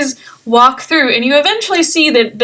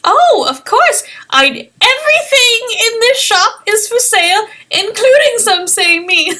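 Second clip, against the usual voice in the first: put-on little old halfling; 9 semitones higher than usual. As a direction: savour his own name like a proud old man. Padre jokes about himself.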